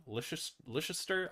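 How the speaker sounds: "Leicester" hesitantly sounded out, rising; unsure how to say "Leicester"; unsure pronunciation. Real, not acted. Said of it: "I don't know how to pronounce words".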